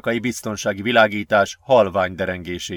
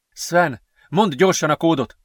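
higher-pitched, urgent request; acted urgency, pitched up; urgent demand; 6 semitones higher than usual. A narrator's reading. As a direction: press him to hurry. Urgent demand while being chased.

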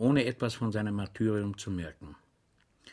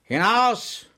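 much higher, louder roar; bellowing; 10 semitones higher, 6 dB louder than usual. A narrator's reading. Official roaring Schweig out of the office, much higher and louder. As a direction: throw him out with one bellow. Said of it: "brüllte der Herr".

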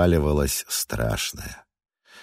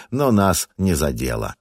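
ordinary delivery

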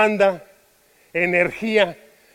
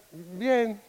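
softer, dropping "Bien" said with no life; flat unhappy fine; 9 dB quieter than usual. Shown as a demonstration. Friend answering how are you, a quiet, deflated "Bien". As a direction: let "Bien" sink, quiet and unconvinced. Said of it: "depende del tono".

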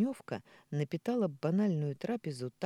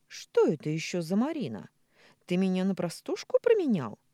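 indignant, louder; jealous; 4 semitones higher, 6 dB louder than usual. A narrator's reading. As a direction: demand an answer, jealous. Jealous wife confronting husband.

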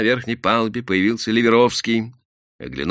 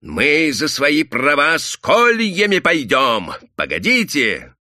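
loud, slow, raised-pitch threat; орёт; 8 semitones higher, 5 dB louder, slower than usual. A narrator's reading. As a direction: yell it like an angry peasant. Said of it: "заорал губастый парень и заросший мужик опять". (угрожающий крик мужика)

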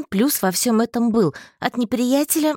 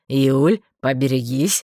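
low, loud warning; brisk heads-up; warning call; 6 semitones lower, 4 dB louder, slower than usual. A narrator's reading. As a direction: call out in a deep male voice. Warns her before the blast.

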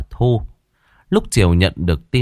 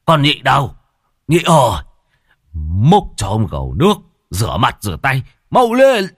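loud, higher call across the yard; calling out breathlessly; 5 semitones higher, 4 dB louder than usual. Acted. Old Khiêm calling the maid Nhị for water, breathless shout. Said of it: "Lão gọi muốn đứt hơi".

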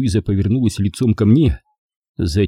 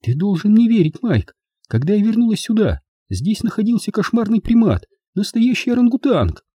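high-pitched tearful pleading; through tears; 12 semitones higher than usual. A narrator's reading. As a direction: beg him to believe, voice pitched well up. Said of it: "заговорила Луиза сквозь слёзы".

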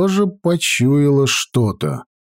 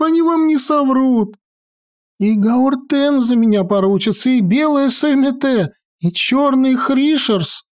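put-on much higher, indignant old wizard; protest, name-dropping in a high voice; high fussy wizard voice; 10 semitones higher than usual. Maestro protests his innocence.

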